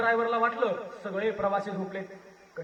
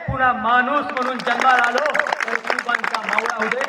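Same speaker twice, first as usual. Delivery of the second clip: fervent repeat, settling; 7 dB louder than usual. Acted. Orator closing his plea to God, loud and settling. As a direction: repeat the wish firmly, bringing the plea to rest.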